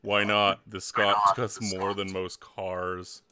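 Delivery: low, unimpressed rich schoolgirl; deep, deadpan snob voice; haughty Vera. Acted. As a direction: answer low and coolly disdainful.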